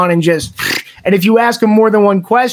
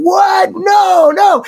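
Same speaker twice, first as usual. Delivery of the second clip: retold shocked shout; 9 semitones higher, much slower than usual. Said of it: "we're all going".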